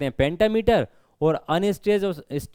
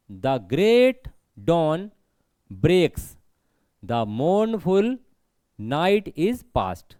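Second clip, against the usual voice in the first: slow, deliberate verse reading; reading the line; much slower than usual. Shown as a demonstration. Teacher reads the poem's first line to the class, slowly. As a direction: read it out word group by word group, with pauses. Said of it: "पहली line को पढ़ो ज़रा".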